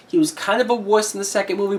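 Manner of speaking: normal talking voice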